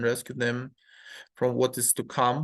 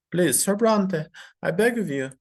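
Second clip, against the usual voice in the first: higher, louder desperate plea; pleading mother; 5 semitones higher, 4 dB louder than usual. Acted. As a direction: beg him to stop, voice raised.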